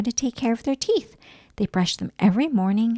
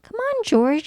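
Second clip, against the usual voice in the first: mild mock shout; 11 semitones higher, slower than usual. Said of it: "shouted Peppa".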